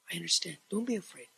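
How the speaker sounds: soft, tender reassurance as Jesus to Peter; gentle comfort. Acted. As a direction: speak it softly, calming a frightened friend.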